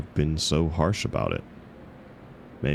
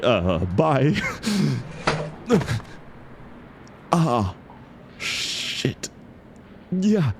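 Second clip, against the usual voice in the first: flustered embarrassment; 5 semitones higher than usual. Acted. Mortified in front of the author, flustered nervous laughter. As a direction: babble your way toward the door.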